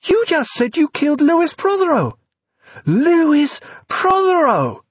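high, loud panicked shriek; hysterical panic. A narrator's reading. Evie, near-hysterical, repeats the dead man's name at V. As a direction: scream the name in disbelief. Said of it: "near-hysterical panic".

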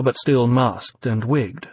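ordinary delivery, nothing marked

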